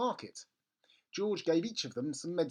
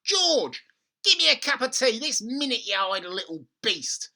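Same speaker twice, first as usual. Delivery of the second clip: put-on high, loud nasty old woman; grandma demanding tea from the roof; bossy grandma; 8 semitones higher, 10 dB louder than usual. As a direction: order him about spitefully.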